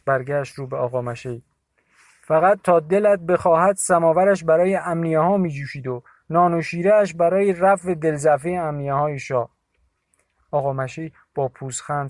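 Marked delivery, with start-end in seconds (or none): 2.2-9.5 much higher, louder angry account; Dashi Molla's indignant voice. A narrator's reading. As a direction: complain bitterly to Bibi. (صدای مرد خشمگین و بلند)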